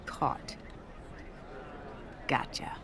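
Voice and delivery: whisper. Acted. Catching Kano by surprise.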